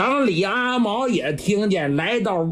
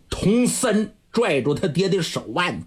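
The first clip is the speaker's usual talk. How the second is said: plain reading voice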